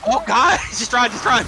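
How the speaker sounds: laughing panicked yell; being chased. Real, not acted. Fleeing a plague monk patrol.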